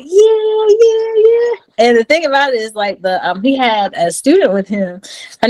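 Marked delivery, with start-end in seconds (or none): 0.0-1.5 high, hyped yeahs; the whole delivery team cheering, excited. Retold or imitated. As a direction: cheer along with the room. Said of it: "everybody was just like".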